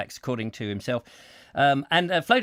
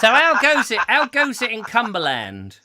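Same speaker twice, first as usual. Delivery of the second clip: jolly loud greeting; 5 semitones higher, 5 dB louder than usual. Acted.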